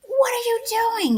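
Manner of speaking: high-pitched indignant yelp, re-enacted; mock road rage. Grandmother re-enacts her reaction to a careless driver, mock road rage. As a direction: act out outrage at a careless driver, pitch leaping up. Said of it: "that's called road rage".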